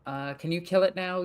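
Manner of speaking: normal talking voice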